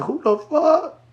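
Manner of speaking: incredulous squeal. Retold or imitated. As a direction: react as if you cannot believe your ears. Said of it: "I was like".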